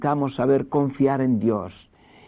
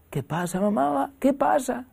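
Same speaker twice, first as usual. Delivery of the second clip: put-on high child's voice, groggy; a boy just woken up; sleepy child; 12 semitones higher than usual. Niño medio dormido responde. (voz aguda de niño adormilado)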